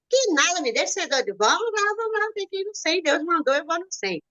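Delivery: high-pitched, insistent retort. Retold or imitated. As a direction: brush off the doubter and insist on going. Retold argument with doubting husband.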